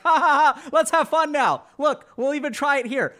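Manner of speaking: high, loud, strained forced cheer; forced fake laughter. Shown as a demonstration. Showing viewers forced fun, high loud fake laughter. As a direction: laugh too hard, desperate to seem fun. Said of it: "I'm gonna show you guys empa-, non-empathic laughter".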